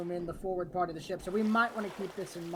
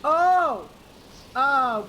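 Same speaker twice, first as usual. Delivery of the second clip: startled shout; 4 semitones higher, 11 dB louder than usual. Real, not acted. Ship nearly capsizes.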